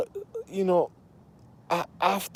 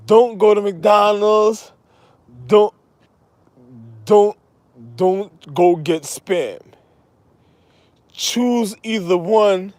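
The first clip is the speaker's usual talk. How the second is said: slow, loud mock warning; 3 semitones higher, 5 dB louder, slower than usual. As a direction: warn them loudly, drawn out.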